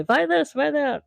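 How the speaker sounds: high-pitched mock vendor pestering; imitated street hecklers. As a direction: hawk it high, mimicking pushy sellers. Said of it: "people that kind of heckle you".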